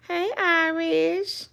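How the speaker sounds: high, slow sing-song greeting. Real, not acted. Greeting her dog Irish in slow, high sing-song baby talk.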